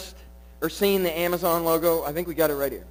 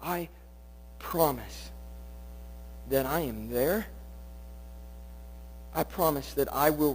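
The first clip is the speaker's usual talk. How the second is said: lower, much softer, tender promise; God's reassurance; 7 semitones lower, 10 dB quieter than usual. Acted. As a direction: speak God's promise gently and low. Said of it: "And God is saying".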